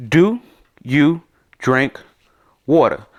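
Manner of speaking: each word set apart by pauses. Shown as a demonstration. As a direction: spell out the translation one word at a time. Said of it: "So let's translate a sentence".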